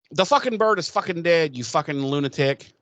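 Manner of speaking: mock Spike snapping at Drusilla, mildly hammed-up; snapping retort. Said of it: "Spike kinda snaps and he's like".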